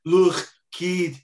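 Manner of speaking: high, loud imitation of a Liverpool man; emphatic mock Liverpool accent. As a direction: do a hammed-up Scouse accent. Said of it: "That's exactly how he sounds".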